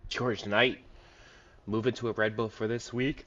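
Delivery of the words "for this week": talking through a yawn; words stretched by a yawn. Real, not acted. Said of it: "Excuse me for the yawn".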